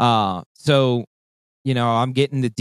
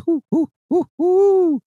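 high hooting owl call, louder at the end; owl hoot imitation; 5 dB louder, slower than usual. Shown as a demonstration. Hunter showing a friend his owl locator call, high hoots. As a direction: hoot like an owl to get a shock gobble. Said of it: "You just".

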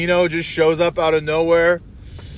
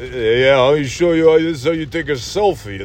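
put-on mumbled old tough guy explaining gadgets; marble-mouthed old-timer impression; Sylvester Stallone. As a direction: slur it like an aging action hero. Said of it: "old guys sitting there not knowing".

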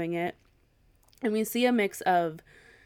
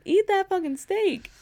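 high, slow, emphatic demand; insisting Andy eat the steak; insistent; 10 semitones higher than usual. Real, not acted. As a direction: lean on every word.

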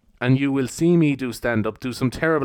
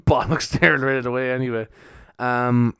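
ordinary delivery

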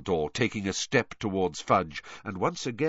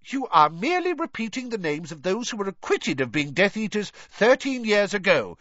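high-pitched, indignant rebuke; blustering official in a temper; angry; 10 semitones higher than usual. A narrator's reading. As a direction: dismiss it angrily, voice pitched up. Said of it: "said Fudge angrily".

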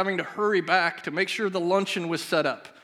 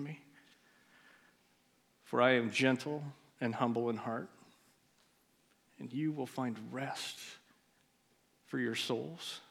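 gentle, humble voice of Jesus; 5 semitones lower, 10 dB quieter than usual. Retold or imitated. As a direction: speak gently, as a friend promising rest. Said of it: "he responded that".

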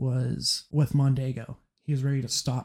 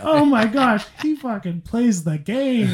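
amazed; 9 semitones higher, 5 dB louder, slower than usual. Real, not acted. Amazed reaction to scene.

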